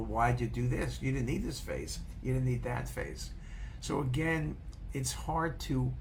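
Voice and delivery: normal talking voice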